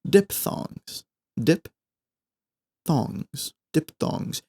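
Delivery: diphthongs breathed out softly; whispered. Shown as a demonstration. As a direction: hush the word like a secret. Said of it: "I'm not making a joke here".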